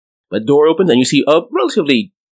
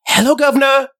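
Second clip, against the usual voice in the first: much higher, quick eerie hello; fast ghost greeting; 13 semitones higher than usual. Acted. Ghost greets Trudy quickly, high and eerie. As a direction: snap it out fast. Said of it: "she says to you real fast".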